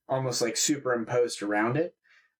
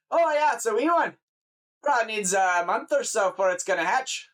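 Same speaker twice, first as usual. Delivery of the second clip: high-pitched Scottish countryman sizing up a find; Scottish lilt, pitched up; Scottish farmer; 8 semitones higher than usual. Acted. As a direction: question them as a Scottish farmer. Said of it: "like a Scottish person or whatever".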